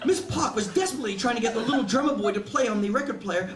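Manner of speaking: ordinary delivery, nothing marked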